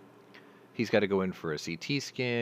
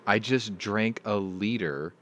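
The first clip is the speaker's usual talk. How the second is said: slow, deliberate dawning realization; slow disbelief; 3 semitones higher, 4 dB louder, slower than usual. Retold or imitated. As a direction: spell it out slowly in disbelief. Realizing he drank a liter.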